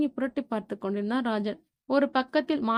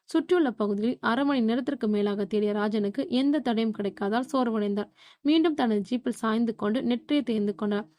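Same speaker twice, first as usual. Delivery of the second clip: plain reading voice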